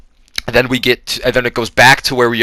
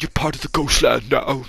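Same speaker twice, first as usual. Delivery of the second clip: lightly put-on softer voice; mock henchman voice; 3 semitones higher, 5 dB quieter than usual. Henchman claiming Bernice for ghost land, soft put-on voice. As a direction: quietly play the movie henchman. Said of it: "the dude who did it was like".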